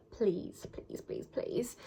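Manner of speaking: pleading please fading to a whisper. Real, not acted. Whispered begging to her viewers to stay in contact. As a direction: beg softly, dropping to a breathless whisper.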